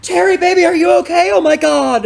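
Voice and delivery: very high, loud imitation of a frantic partner; mock alarmed girlfriend. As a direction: squeal it in hammed-up concern. Acted girlfriend pulls up alarmed.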